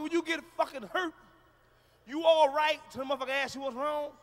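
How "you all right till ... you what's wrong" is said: ordinary talk, nothing marked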